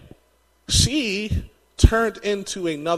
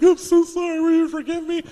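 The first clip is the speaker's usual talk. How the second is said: much higher, pleading imitation of the wife; wife's apology; 10 semitones higher than usual. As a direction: play the wife saying sorry in a high voice. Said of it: "She's like".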